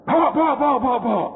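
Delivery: mock urgent command from David; urgent repeated order. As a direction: rush them to carry it, half-panicked. David urgently ordering the ark moved.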